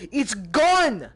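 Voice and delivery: sudden loud high cry of dismay; dismayed yell. Real, not acted. Gamer yells as his cigar disappears. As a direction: shout out as the thing vanishes.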